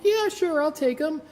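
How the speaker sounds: acting keen to the coworker so as not to be rude; mock eager yes. Overeager accepting coworker's old toys, high and bright. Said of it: "And I'm like".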